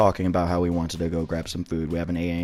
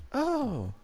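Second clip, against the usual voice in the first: mock playing along; 13 semitones higher, slower than usual. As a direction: play along in a high put-on voice. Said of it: "he was trying to troll her".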